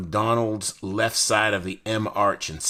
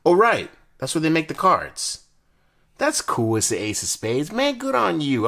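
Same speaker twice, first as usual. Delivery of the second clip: excited; 9 semitones higher, 8 dB louder than usual. Real, not acted. Excited reaction to card factory.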